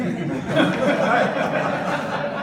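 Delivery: bursting into a laugh; a real laugh, high and loud. Real, not acted. Laughs at own joke.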